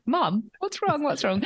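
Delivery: put-on kids asking. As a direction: ask like an anxious child. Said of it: "the kids were like".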